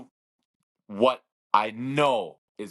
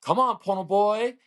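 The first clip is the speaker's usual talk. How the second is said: brother calling from the crowd; 9 semitones higher, 4 dB louder than usual. Retold or imitated. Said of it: "and he said".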